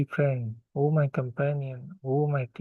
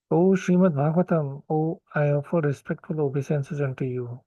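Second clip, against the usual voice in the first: ordinary talk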